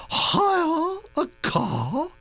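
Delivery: drawn out high, slow outrage; appalled old lady dragging out Hire; scandalized. A narrator's reading.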